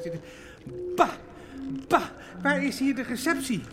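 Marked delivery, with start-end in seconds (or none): 1.0-2.0 disgusted bah. Acted. Disgust at banana peels.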